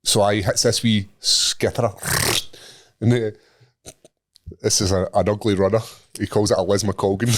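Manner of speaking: usual speaking voice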